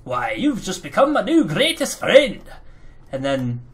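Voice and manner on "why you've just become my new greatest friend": higher, louder hammy delight; Gadzooks delighted. Retold or imitated. Gadzooks thanking the gnome for the pint, hammy delight. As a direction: gush like an old-timey hero. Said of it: "he's like".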